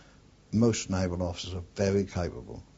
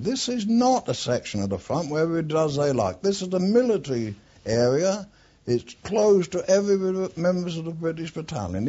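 loud, high, furious telling-off; raised voice, ordering an intruder out; angry shout; 9 semitones higher, 6 dB louder than usual. Retold or imitated. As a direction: bark him off the front line. Shouting Hemingway away from the front.

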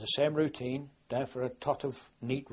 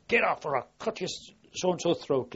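a touch of a fierce threat, slightly raised; 4 semitones higher, 4 dB louder than usual. Retold or imitated. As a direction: warn him off with a mild mock snarl. Threatening a drowning man with knife.

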